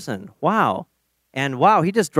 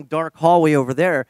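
ordinary delivery

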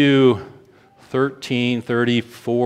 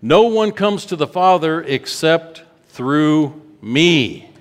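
higher and slow, weighty; slow proclamation; 6 semitones higher, 5 dB louder, slower than usual. Acted. Preacher quoting Jesus to the church, slow and weighty. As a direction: lay each word down.

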